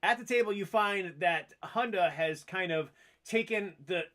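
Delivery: ordinary delivery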